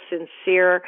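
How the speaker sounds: normal talking voice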